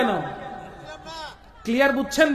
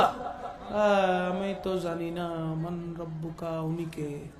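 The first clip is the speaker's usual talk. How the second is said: much lower and much softer, slow and bewildered; confused dead soul; 7 semitones lower, 10 dB quieter, much slower than usual. Acted. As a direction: mumble like a lost soul who knows nothing. Mimics clueless soul in grave. (বিভ্রান্ত কবরবাসীর নিচু ফিসফিসে স্বর)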